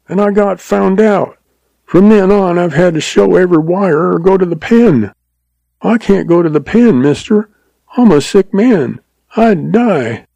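high, desperate pleading; blubbering. A narrator's reading. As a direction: beg in panic, pitched high. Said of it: "blubbered the agent".

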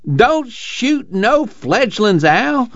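lightly voiced old grandpa, high and slow at first; a mild mock holler from a cranky old man; hammed-up grandpa shouting a warning, pitched high; old man's yell. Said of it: "Grandpa Lee yells".

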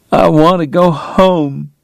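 high, slow plaintive longing; whispered plea. A narrator's reading. Woman resting on Doc's chest, plaintive plea. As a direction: let it out slowly, pitched high. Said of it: "she whispered".

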